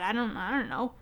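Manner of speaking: ordinary talk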